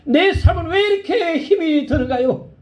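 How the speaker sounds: high, pleading lament to God; lonely crying prayer. Retold or imitated. Woman alone after surgery cries out to God, high and pleading. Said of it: "너무나 외로워서 펑펑 울었답니다".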